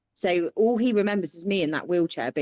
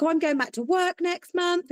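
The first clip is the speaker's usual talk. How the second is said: breezy cheer; 12 semitones higher than usual. Retold or imitated. Her breezy reply to doctor.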